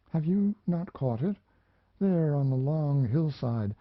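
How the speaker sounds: put-on a lonely young woman remembering, a little lifted; young woman's voice. Mariana speaks to the narrator.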